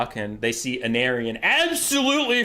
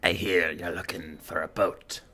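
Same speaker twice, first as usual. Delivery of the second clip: much lower, quiet sage voice; mysterious old man; 10 semitones lower, 5 dB quieter than usual. Acted. Old man tempts the wanderer, low and hushed. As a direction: murmur it like a cryptic hermit.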